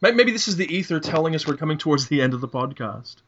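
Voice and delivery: usual speaking voice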